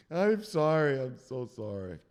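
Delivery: slow apology dying away; whimpered apology. Acted. Victim apologizing to the enforcer, broken and fading.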